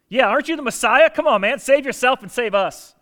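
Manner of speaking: mock him like a criminal beside him; a criminal on the cross taunting Jesus; jeering taunt. Criminal hurling insults at Jesus.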